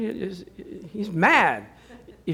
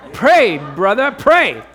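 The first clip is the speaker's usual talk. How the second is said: loud, slow plea to pray; a loud, drawn-out demand for prayer; urgent plea; 2 semitones higher, 7 dB louder, slower than usual. Retold or imitated. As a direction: call out for the brother to pray.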